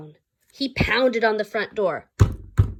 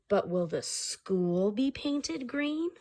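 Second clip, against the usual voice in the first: hushed, slow principal; 8 dB quieter, slower than usual. Acted. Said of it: "said Brogan O'Neill".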